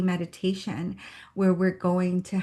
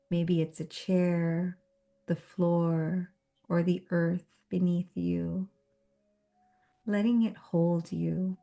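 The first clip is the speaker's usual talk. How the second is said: guided meditation. Real, not acted. Host grounding a meetup group before the session, guided meditation. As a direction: guide them gently through each breath, unhurried.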